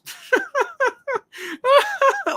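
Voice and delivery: high, cackling imitation of people laughing; mocking laugh. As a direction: laugh at him like the scene does.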